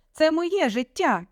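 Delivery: high, slow defiant cry; shouting. A narrator's reading. Daughter claiming her life from parents, shouting. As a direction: stretch it out as a heated protest. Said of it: "теж почала кричати".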